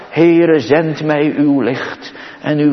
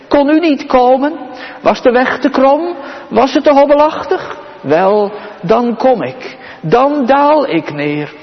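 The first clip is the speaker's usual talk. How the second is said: higher, pleading questions of the Lord; voicing the Lord; 8 semitones higher than usual. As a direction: ask as God coming down to the weary. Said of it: "En hij zegt het".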